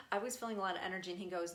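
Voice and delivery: normal talking voice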